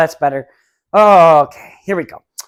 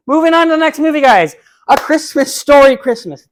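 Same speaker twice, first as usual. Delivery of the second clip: loud, high showman voice announcing the next movie; big announcer intro; 9 semitones higher, 9 dB louder than usual. Acted. Reviewer to viewers, loud showy announcer voice for the next movie. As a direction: belt out the title like a game show host.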